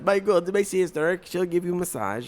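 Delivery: high-pitched mock-foreign accent; Borat.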